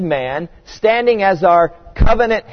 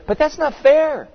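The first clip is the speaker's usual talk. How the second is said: put-on high, whining child's complaint; whiny seven-year-old; 9 semitones higher than usual. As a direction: whine it like a sulky kid. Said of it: "start sounding like a whiny seven-year-old".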